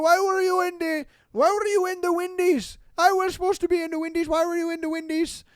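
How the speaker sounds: loud, high rant in a put-on Middle Eastern accent; mock raging. Minivan driver raging at the truck's riders, mock outrage. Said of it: "starts raging at us".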